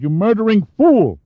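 furious shout. A narrator's reading. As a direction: hurl it at him in rage. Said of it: "Lusana shouted".

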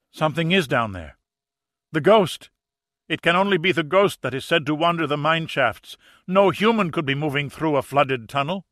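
high, loud shout tinged with horror; panicked shout of horror; horrified shout. A narrator's reading. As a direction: yell it in terror. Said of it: "shouted, his voice tinged with horror".

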